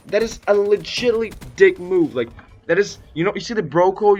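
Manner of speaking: loud, high-pitched indignant tirade; angry rant. Real, not acted. Storyteller tells off the friend who betrayed him, loud angry rant. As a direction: rant at full volume, hammering the key words.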